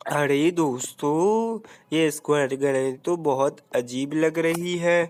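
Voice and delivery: slow low mock trailer voice; deep announcer voice. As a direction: drop low and drag it out to the viewers. Deep announcer aside to viewers.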